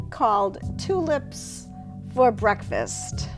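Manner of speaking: ordinary talk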